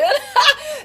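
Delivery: a high, loud, real laugh at herself; burst of laughter. Real, not acted. Brazilian singer laughing at her own English.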